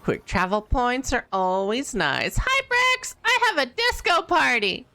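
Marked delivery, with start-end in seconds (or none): 2.4-4.9 high, squeaky playful greeting; squeaky silly voice. Acted. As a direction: chirp it up high like a cartoon.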